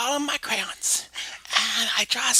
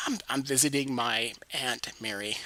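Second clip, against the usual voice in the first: put-on low, much softer boy voice; Jimmy voice; 10 semitones lower, 8 dB quieter than usual. Mary plays the shy boy Jimmy, low and soft. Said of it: "He goes".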